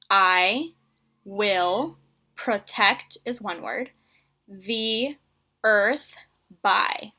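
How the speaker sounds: slow word by word, with pauses; counting the sentence starter with kids; counting words slowly. Shown as a demonstration. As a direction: count each word out on your fingers. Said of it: "let me say that a little bit slower".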